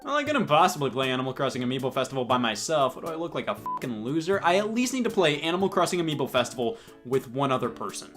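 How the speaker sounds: ordinary delivery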